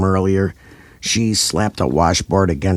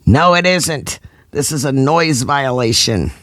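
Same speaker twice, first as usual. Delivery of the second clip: yelled; 8 semitones higher than usual. A narrator's reading. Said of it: "historian ... yelled back".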